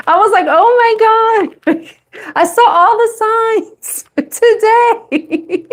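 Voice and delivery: high laughing disbelief; giggling through the words. Real, not acted. Laughing to her viewers about the signs she keeps seeing.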